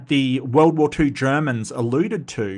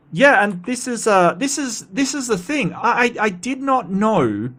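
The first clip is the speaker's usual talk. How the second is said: excited; 8 semitones higher than usual. Real, not acted. Excited, surprised, higher pitch.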